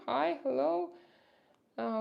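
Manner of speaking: normal talking voice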